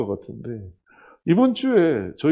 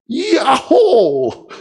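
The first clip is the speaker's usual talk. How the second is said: long, drawn-out high whoop; loud acted shout of joy; jubilant inner cheer; 10 semitones higher, 9 dB louder, much slower than usual. Director inwardly celebrating an unexpected call, jubilant whoop. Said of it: "하는 마음이 딱 드는 거예요".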